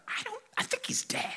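hushed, breathless dread; worried whisper. Retold or imitated. Whispering to Tyler that Micah may be dead. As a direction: whisper the fear.

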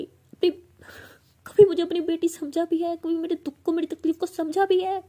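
high, loud, tearful shout of rage; shouting at mother. Retold or imitated. Daughter screaming at her mother through tears. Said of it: "ऐसे बहुत ज़ोर से चिल्लाते".

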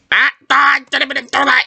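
high-pitched startled "ah, ah"; quick, high cries of surprise; startled yelp. Real, not acted. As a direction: yelp in sudden alarm. Startled while playing a game.

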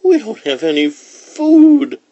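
put-on very high, loud child's complaint; loud, high-pitched little-brother grievance; complaining. A narrator's reading. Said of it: "Chaim complained".

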